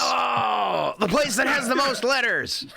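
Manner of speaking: the penny drops, blurt it out with laughter; sudden realization. Real, not acted. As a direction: exclaim it high, half laughing. Suddenly gets the riddle's answer.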